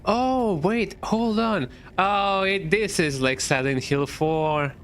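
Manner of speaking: high, slow, thrilled exclamation; excited. Real, not acted. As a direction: gasp with delight at the discovery. Sudden excited discovery, very high.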